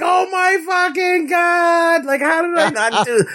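high, loud disbelieving yell; bellowing shout. Retold or imitated. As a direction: bellow it out at the screen. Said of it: "I bellowed loudly".

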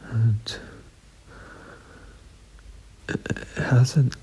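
hushed unvoiced aside; whisper. Real, not acted. Owner whispering a wry aside about the puppy. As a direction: whisper it so the dog won't hear.

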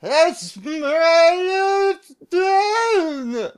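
very high, loud, stretched-out crying yell; drunk man wailing shout. Retold or imitated. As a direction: howl it out like a drunk losing it. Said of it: "some screaming".

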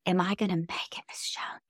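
breathless whispered plea through tears; retold whisper. Young man whispers to his boss through tears. Crying employee asks for reassurance.

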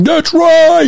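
high, loud retold yell of the man shouting after them; imitated scream. Mimicking the man's shout as they walked away, loud. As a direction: belt it out like the street preacher. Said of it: "And he's screaming real loud".

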